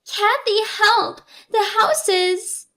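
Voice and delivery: high, urgent cry for help; slow, high-pitched panicked sister on the phone; panicked plea. A narrator's reading. Sister calls Kathy for help, in a high panic.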